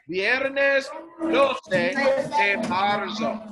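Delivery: very slow, loud, syllable by syllable; slow and clear. Shown as a demonstration. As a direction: model it slowly for kids to repeat. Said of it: "Let's say that together".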